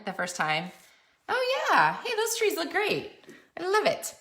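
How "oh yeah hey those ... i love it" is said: high, bright burst of real delight; thrilled exclaiming over the finished trees. Real, not acted. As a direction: squeal with joy at how it came out. Delighted at how the trees turned out.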